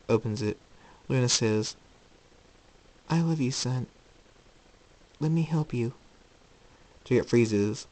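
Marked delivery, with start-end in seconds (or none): 3.1-5.9 loving mother, pleading. Acted. Luna calling to her departing son, tenderly pleading. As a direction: coax your child tenderly.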